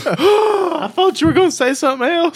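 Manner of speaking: gasp, then high laughing words; real amused gasp and laughter. Real, not acted. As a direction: laugh it out in surprise. Host reacts to surprise ending.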